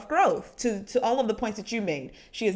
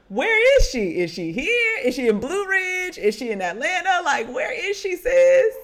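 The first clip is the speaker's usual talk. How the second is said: much higher, frantic, pitching each question up; higher, incredulous, asking the room where she went; mock bewildered search; 8 semitones higher, 5 dB louder than usual. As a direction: act out a baffled castmate hunting for a missing guest. Friends speculating, acting out confusion.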